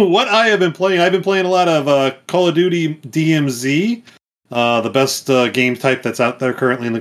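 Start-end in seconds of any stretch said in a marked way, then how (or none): none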